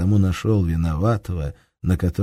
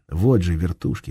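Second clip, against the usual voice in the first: ordinary delivery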